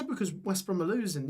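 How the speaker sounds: usual speaking voice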